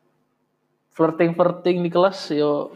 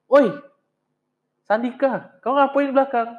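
lightly voiced teacher calling out, higher pitch; retold teacher; 5 semitones higher than usual. Teacher catching a student at the back, lightly imitated. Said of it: "guru aku nih nengok".

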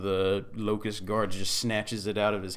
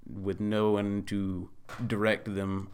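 usual speaking voice

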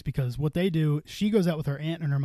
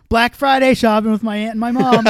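loud, raised read-out of someone's post; quoted tweet; 7 semitones higher, 10 dB louder than usual. Retold or imitated. Drunk friend reads out a tweet he is replying to, loud and raised. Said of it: "Like, if they said".

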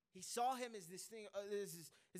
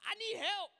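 high, loud, hammed-up cry for help; mock cry for help; 14 semitones higher, 10 dB louder than usual. Preacher playing a scared rider, loud mock plea for help. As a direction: yelp it like you're stuck on the ride.

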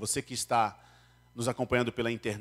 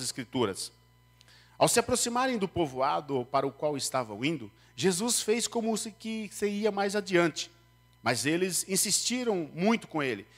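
higher, solemn Bible reading; scripture reading; 6 semitones higher, 3 dB louder than usual. Acted. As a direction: read the passage to the congregation. (leitura bíblica solene)

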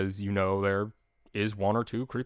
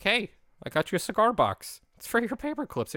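much higher, eager puppet voice of Bert offering a gift; 12 semitones higher than usual. Retold or imitated. Bert handing Ernie the cigar box, high puppet voice. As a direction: chirp it up high like a muppet handing over a present.

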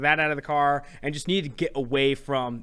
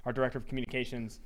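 softer, away from the microphone; far from mic; 2 semitones lower, 8 dB quieter than usual. Real, not acted. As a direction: talk from across the room. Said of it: "I'm gonna step away from the mic here".

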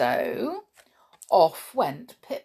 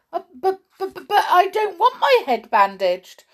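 high, loud stammered protest; alarmed; 12 semitones higher, 7 dB louder than usual. A narrator's reading. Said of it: "said Perry in alarm".

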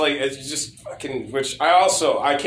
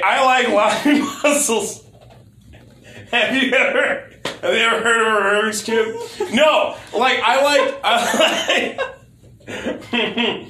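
giggly, raised voice; laughing while talking; 7 semitones higher, slower than usual. Real, not acted. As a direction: talk through giggles. Laughing through the lines.

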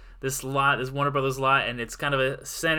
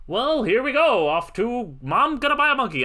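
much higher and louder, sing-song mockery; mock-chirpy; 10 semitones higher, 4 dB louder than usual. Host mocking the film's whimsy, chirpy and loud. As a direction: send up a cheery family outing. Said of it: "and not just like".

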